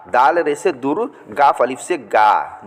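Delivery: ordinary talk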